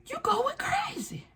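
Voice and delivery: mock gaslighting. Gaslighting Effie.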